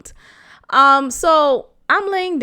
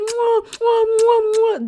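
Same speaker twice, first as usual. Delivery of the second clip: put-on high, smacking baby-kiss noises; imitating his kissing noises on her cheek, high smacks; imitated kiss smacks; 10 semitones higher than usual. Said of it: "try my best to, uh, imitate the noises".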